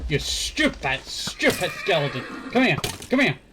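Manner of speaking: angry yelling. Real, not acted. Gamer yelling at a skeleton, angry and loud. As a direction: shout it down at the monster.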